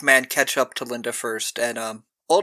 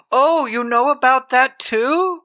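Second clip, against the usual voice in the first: high, slow squeaky take on Kirk; mock Kirk; 11 semitones higher, 4 dB louder, slower than usual. Friend retelling a horror film mocks Kirk in a high, slow voice. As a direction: play him as a wounded, high-pitched dope. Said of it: "And Kirk goes".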